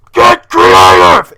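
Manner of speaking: full-throated slow scream. Acted. Motivator on his shoulder screaming at him, all-out bellow. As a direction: scream the order, stretching it out. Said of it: "screaming at me constantly going".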